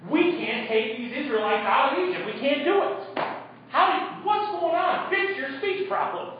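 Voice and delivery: hammed-up fluster, pitch rising line by line; mock flustered God. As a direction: play God as a panicked manager caught out. Mock God scrambling over Moses' excuse.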